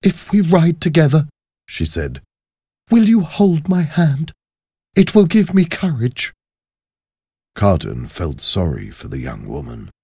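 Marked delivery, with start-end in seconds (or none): 0.0-1.3 put-on high, earnest young woman's voice; high-voiced, hopeful prisoner. 2.9-6.3 put-on high, earnest young woman's voice; high-voiced, hopeful prisoner.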